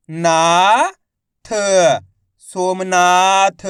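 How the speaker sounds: loud, slow spelling out of each word; syllable-by-syllable reading lesson. Shown as a demonstration. Teacher sounding out words for young learners, slow and loud. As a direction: call out each syllable, then join them slowly.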